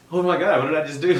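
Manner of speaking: high, loud hammed-up panic; imitating someone realizing a huge blunder; mock panic. Bert realizing what he just sent Whitney, loud mock panic. Retold panic after sending photo.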